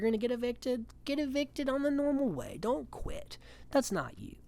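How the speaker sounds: high, earnest mock pleading; a hint of a pleading friend; pleading Roddy. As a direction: pitch up and urge him not to quit. Roddy urging Josh, quoted.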